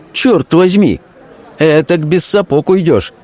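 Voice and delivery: high-pitched outcry of gamblers; acted exclamations. A narrator's reading. Gamblers reacting to the dealer's luck, exclaiming. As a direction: exclaim it high, as losers round the table. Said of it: "Раздались возгласы".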